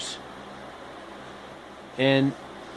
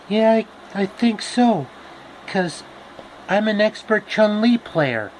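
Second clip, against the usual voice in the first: Dorothy voice; 7 semitones higher than usual. Acted. Dorothy explains the witch.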